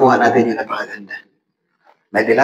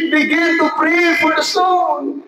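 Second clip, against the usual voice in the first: preached shout; 11 semitones higher, slower than usual. Shown as a demonstration. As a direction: proclaim it to the whole hall. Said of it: "Kaya, mga kapatid".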